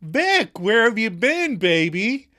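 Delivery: high, loud theatrical call; stagey greeting. Acted. [name] greets Joey on stage, hammed-up loud call. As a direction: overplay a delighted stage greeting.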